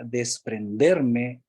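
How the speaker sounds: desprenderme drawn out very slowly, each syllable weighed; slow emphasis on desprenderme. Shown as a demonstration. Coach pointing out a powerful word to students, desprenderme stretched slowly. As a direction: linger on desprenderme to show its weight. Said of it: "miren, esta frase, esta palabra".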